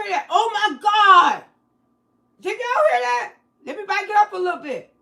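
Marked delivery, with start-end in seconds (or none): 0.3-3.3 shocked shout. Real, not acted. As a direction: blurt it out, stunned. Reacts to the call audio.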